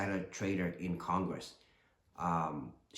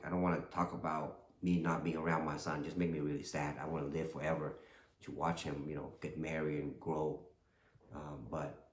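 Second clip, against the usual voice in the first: getting emotional; 4 semitones lower, 6 dB quieter than usual. Real, not acted. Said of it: "that got me a little emotional".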